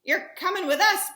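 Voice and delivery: put-on bossy captain telling the boy he comes along; pirate captain.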